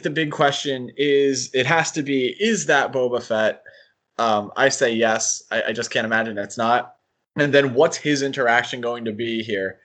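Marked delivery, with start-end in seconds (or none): none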